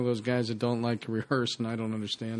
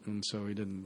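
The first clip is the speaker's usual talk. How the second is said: usual speaking voice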